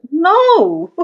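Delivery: high, loud, drawn-out "no"; emphatic denial. Real, not acted. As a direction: burst out with a laughing-off "no".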